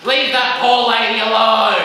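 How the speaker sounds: loud shouting mum; exasperated mother's shout; mum yelling. Retold or imitated. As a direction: yell after the kid on the bike. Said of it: "and his mum went".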